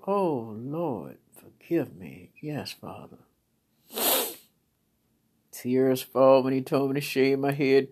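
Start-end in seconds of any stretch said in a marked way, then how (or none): 0.1-3.2 much softer, humbled prayer; hushed contrite prayer; soft surrender. Retold or imitated. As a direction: drop low and quiet, yielding. Surrendering to God.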